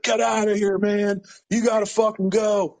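high, pushed voice of a friend urging him off; urgent friend's voice. Retold or imitated. Friend on the phone urging him to run, high-pitched and pushing. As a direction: shove him out the door with your voice.

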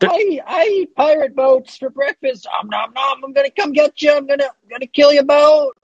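a kraken playfully announcing itself. Acted. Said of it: "It sounds like a kraken".

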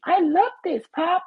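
put-on very high, loud squeaky little-boy voice; Mickey Mouse-like falsetto kid voice; young son's voice. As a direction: squeal it like an excited four-year-old. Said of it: "that's how his voice is".

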